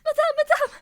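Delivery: loud breathless cry for madame; urgent calling out. Acted. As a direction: burst in calling for help. Maid bursts in calling for help.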